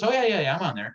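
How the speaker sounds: high, loud eager yeah yeah; brightly oversell being on Instagram; eager old agent. Retold or imitated. Older agent bluffing to the seller, eager and high. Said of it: "He goes".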